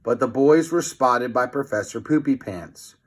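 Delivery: usual speaking voice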